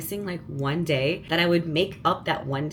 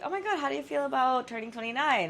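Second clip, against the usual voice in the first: mock gushing friend; 7 semitones higher, faster than usual. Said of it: "Everyone keeps asking me".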